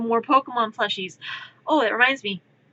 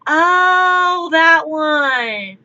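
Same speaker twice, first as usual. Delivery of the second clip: high, loud drawn oh of realization; mock sudden recognition; lightly hammed oh; 4 semitones higher, 5 dB louder, much slower than usual. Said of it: "and I'm like".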